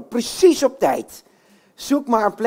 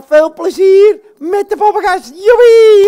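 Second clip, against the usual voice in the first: much higher, loud whoop; excited cheer; 12 semitones higher, 12 dB louder than usual. Acted. Puppet kicks off the show for the kids with an excited cheer. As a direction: burst out with joy.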